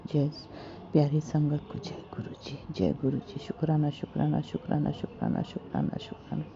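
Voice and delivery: soft murmured sign-off, trailing away; hushed closing chant, fading out; fading devotional thanks. Real, not acted. Closing repeated thanks to the guru.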